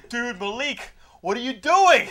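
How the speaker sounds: hammed-up panicked buddy; mock alarm. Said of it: "the main character's like".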